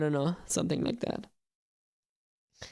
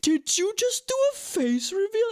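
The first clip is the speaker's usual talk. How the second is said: high excited fan voice; 13 semitones higher than usual. Retold or imitated. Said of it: "They're like people doing".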